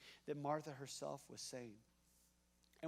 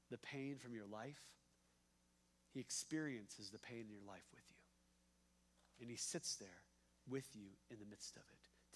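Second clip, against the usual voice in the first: quiet tender preaching; 6 semitones lower, 8 dB quieter than usual. Acted. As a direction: lower the voice and draw the listeners in close.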